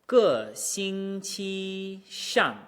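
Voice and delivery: slow "个星期上", reset high then slide out; drop, hold high, drop on "个星期上"; entrance and exit. Shown as a demonstration. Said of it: "Difficult entrance".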